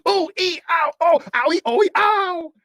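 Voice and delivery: high, loud cries of pain; hammed-up "owie" squeals; tumbling yelps. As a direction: yelp at each bump. Jack falls down the hill.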